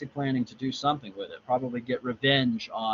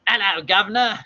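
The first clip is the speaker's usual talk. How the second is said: loud, high Cockney greeting; hammed-up Cockney hail; Cockney accent; 9 semitones higher, 9 dB louder than usual. Shown as a demonstration. Reader showing Cockney against proper British, loud high greeting. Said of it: "with the Cockney accent".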